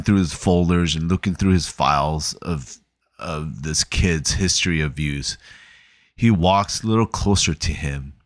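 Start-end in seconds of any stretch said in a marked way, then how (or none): none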